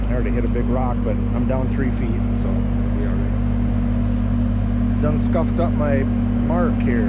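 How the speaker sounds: loud, talking over machine noise. Real, not acted. To the camera over the auger, loudly. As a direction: project your voice over the auger.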